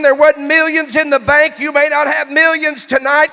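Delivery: loud, driving revival preacher; shouted, rallying sermon delivery; full-force preaching. Real, not acted. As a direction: fire up the congregation at full volume. Preacher rallies the congregation.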